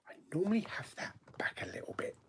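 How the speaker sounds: fast whispered aside. Real, not acted. Streamer whispering about the camera, whispered. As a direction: whisper it quickly to yourself.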